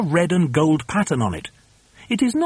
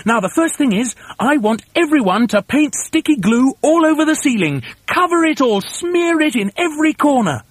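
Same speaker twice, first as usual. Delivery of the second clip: high, excited bossy orders; rally everyone with high, urgent commands; high-pitched frantic foreman; urgent cry; 10 semitones higher, 3 dB louder than usual. A narrator's reading. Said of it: "cried Mugglewump".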